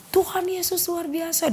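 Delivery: high but softened mock cry; retold shout. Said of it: "Dia teriak". (menirukan teriakan, tinggi tapi pelan)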